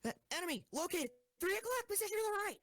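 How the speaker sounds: alarmed callout. Acted. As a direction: shout the warning urgently. Spotting enemy in battle.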